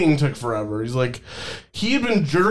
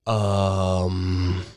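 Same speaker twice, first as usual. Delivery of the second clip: clueless customer; 9 semitones lower, much slower than usual. Retold or imitated. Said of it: "He's just like".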